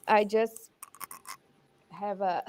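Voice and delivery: ordinary delivery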